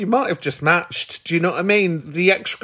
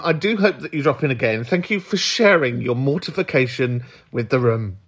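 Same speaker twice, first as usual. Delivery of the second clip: ordinary talk, nothing marked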